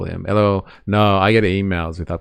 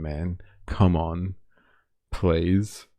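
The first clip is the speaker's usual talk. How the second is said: normal talking voice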